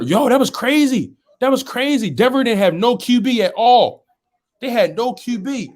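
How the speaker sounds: can't believe what he saw; hyped disbelief. Real, not acted. Hyped about Denver game.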